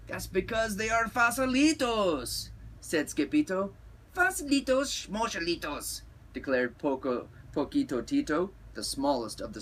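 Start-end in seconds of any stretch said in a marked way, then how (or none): 0.1-2.5 high-pitched eager kitty hero; Skipito. A narrator's reading. Skipito explains to the Chihuahuas, high cartoon voice. 4.1-6.0 high little-dog voice; tiny scoffing Chihuahua. A narrator's reading. Smallest Chihuahua dismisses Skipito, high scoffing voice.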